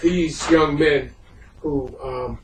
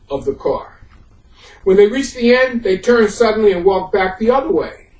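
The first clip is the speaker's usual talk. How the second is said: measured, earnest read-aloud narration; story narration; 6 semitones higher, 5 dB louder than usual. A narrator's reading. Speaker reading Stories of Scottsboro to his audience, in steady narration. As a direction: carry the listeners through a true story, line by line.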